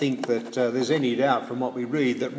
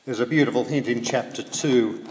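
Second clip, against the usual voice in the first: normal talking voice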